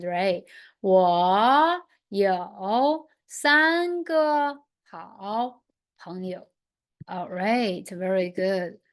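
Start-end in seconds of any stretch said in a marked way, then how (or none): 0.8-6.5 said slowly, word by word, for learners; slow model sentence. Shown as a demonstration. Teacher modelling a sentence for students, slowly. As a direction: spell out each word slowly. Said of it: "the sentence should be".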